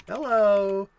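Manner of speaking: loud, high, pleased hello; delighted hello. Real, not acted. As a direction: greet the find with glee. Spots the inflation calculator result.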